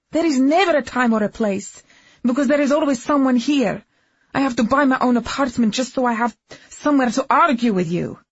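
loud, high angry yelling; furious shouting. Acted. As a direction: scream it at him in fury. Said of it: "a woman screaming".

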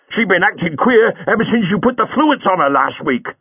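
a burly cabbie squaring up; cab driver Buller. A narrator's reading. Buller accuses Chang.

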